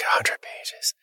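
whispered in disbelief; dismayed whisper. Retold or imitated. Client hearing the page count, a stunned whisper. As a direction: breathe it out, stunned by the number. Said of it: "He's like".

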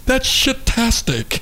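slow, savoring the made-up word; drawn-out mock word. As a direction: stretch it out for laughs. Coining a silly word slowly.

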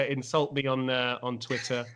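ordinary talk, nothing marked

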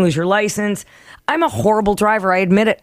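usual speaking voice

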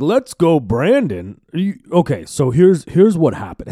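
high, loud mock excitement; imitating giddy grandfather. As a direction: gush it as a delighted grandpa bursting to explain. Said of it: "He was so happy".

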